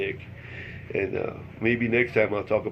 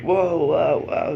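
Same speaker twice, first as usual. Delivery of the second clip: higher, louder imitation of a startled drunk; mock drunken amazement; 6 semitones higher, 4 dB louder, much slower than usual. Drunk girlfriend finding she wet herself, imitated amazement. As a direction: act out her tipsy astonishment.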